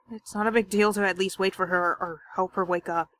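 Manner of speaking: normal talking voice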